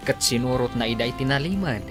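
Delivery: ordinary talk, nothing marked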